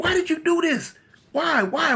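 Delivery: high, pleading questioning; distraught mama. Acted. KC's mama looking at her son, high distraught pleading. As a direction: demand it high in acted dismay.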